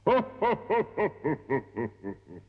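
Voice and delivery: booming ho-ho-ho that tapers off; thunderous giant laugh. A narrator's reading. Giant laughs at his tiny captive, thunderously. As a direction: let out a huge giant's belly laugh. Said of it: "a laugh as loud as thunder".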